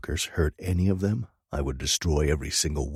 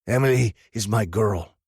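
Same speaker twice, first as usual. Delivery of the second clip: blurted; 5 semitones higher, 4 dB louder than usual. A narrator's reading. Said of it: "Dodge blurted".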